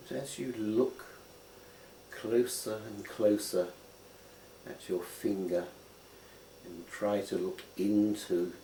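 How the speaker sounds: unhurried meditation guide; guided contemplation. Acted. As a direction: lead them gently into looking closely. Guides a slow finger contemplation.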